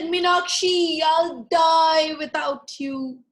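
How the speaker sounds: loud, high, slow overwrought plea; melodramatic lovesick lizard. Acted. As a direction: beg her like a tragic lover. Lizard declares love dramatically.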